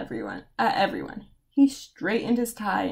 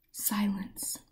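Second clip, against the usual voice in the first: a breathless whispered pause; whispered hush; 2 semitones lower, 10 dB quieter than usual. A narrator's reading. Dramatic hush after chaos.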